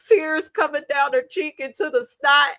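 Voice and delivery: ordinary talk, nothing marked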